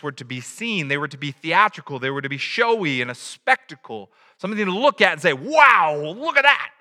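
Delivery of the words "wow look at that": mock amazement. Mocking onlookers gawking at showy religion.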